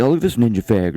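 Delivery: ordinary talk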